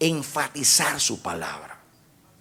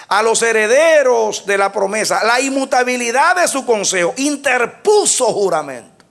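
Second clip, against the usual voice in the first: full-force preaching; 10 semitones higher, 7 dB louder than usual. Acted. Preacher proclaiming the verse to the congregation, full force. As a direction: proclaim the oath to the church.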